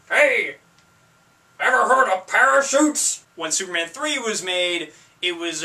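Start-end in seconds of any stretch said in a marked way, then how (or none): 0.1-3.2 loud, higher mock movie-villain voice; imitating Lex Luthor's double. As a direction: punch it out in a loud put-on voice. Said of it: "talks like this".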